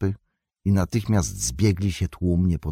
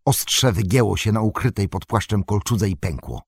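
plain reading voice

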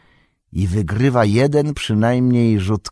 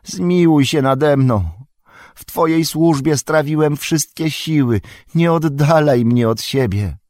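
grovelling Wormtongue; 6 semitones higher, 4 dB louder than usual. A narrator's reading. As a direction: beg not to be cast away. Wormtongue begs on ground.

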